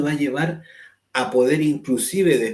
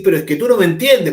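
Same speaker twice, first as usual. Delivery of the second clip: fast, loud, higher protest; raising voice in anger; 6 semitones higher, 11 dB louder, faster than usual. Retold or imitated. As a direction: snap back, loud and quick, feeling misunderstood. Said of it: "y empieza a levantar más la voz".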